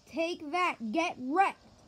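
cocky victory jeers; gloating taunt. Real, not acted. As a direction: rub it in the boss's face. Taunting the boss.

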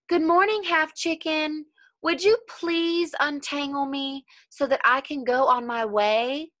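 a gentle, bright-voiced wind asking a favour; voicing the wind. A narrator's reading. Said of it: "asked the wind".

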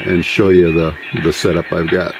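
usual speaking voice

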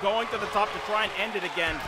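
excited live play-by-play call; wrestling commentator. Acted. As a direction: call the match like a ringside announcer.

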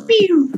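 high playful pew sound; sound effect pew. Pretend. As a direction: zap it out like a laser. Punching a mob in game.

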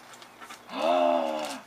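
sharp gasp of delight; surprised gasp. Real, not acted. Opening gift.

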